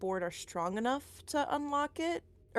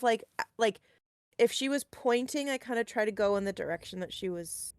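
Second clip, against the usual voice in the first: usual speaking voice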